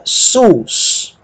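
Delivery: sus singled out and drawn long; stressed syllable. Shown as a demonstration. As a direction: set sus apart as the accented syllable. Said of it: "e a segunda na sílaba".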